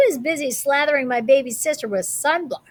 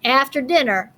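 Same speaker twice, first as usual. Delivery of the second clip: ordinary delivery, nothing marked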